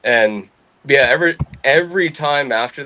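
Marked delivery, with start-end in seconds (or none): none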